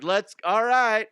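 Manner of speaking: high, slow, mock-casual go-ahead; a lightly voiced carefree worker; careless workers. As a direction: play the careless crew sending her off. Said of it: "They're like".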